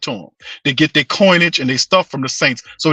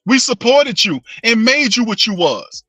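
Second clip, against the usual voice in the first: hammed-up lecture to a spoiled kid, pitched way up; fed-up fan scolding the team; 8 semitones higher than usual. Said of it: "You like".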